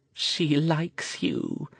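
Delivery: high, slow and timid, as if afraid to speak; an old woman shyly offering, voice trembling a little; shy, shaky voice. A narrator's reading. Old woman to the mage, shy and shaky. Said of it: "in a shy, shaky voice".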